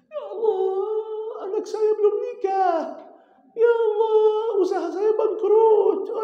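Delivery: high, loud, crying lament; wailing prayer. Acted. Preacher mocking a worldly prayer with a loud crying wail. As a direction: wail about worldly troubles like a begging worshipper.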